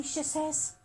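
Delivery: ordinary delivery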